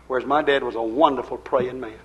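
usual speaking voice

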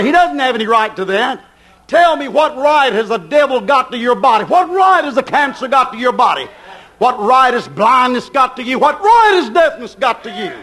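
normal talking voice

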